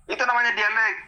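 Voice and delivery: ordinary talk, nothing marked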